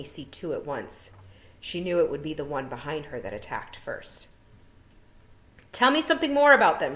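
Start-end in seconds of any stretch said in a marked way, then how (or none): 5.7-7.0 high, loud and fast demand; urgent. A narrator's reading. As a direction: push for answers, under threat. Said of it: "she said".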